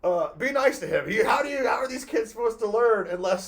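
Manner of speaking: high, loud shouted scolding; imitated dad shouting. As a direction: shout it like a dad breaking up the fight. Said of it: "my dad finally was like".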